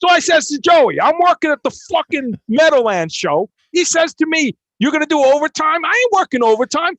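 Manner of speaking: loud, high-pitched, hammed-up Jersey loudmouth; Jersey guy accent. Imitating a Jersey crew guy griping about overtime, loud Jersey accent. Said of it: "Jersey, the whole fucking day, there's a guy going".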